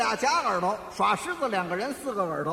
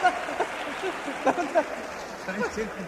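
long, high run of laughter; laughing; 4 semitones higher, 4 dB quieter than usual. Real, not acted. Loser hears the absurd riddle answer, helpless laughing. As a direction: keep laughing in disbelief.

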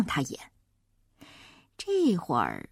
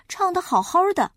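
ordinary delivery

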